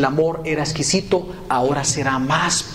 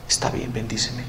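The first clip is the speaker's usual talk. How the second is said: quiet surrender; 3 semitones lower, 6 dB quieter than usual. Acted. Husband yields to wife's blessing.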